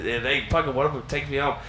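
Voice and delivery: ordinary talk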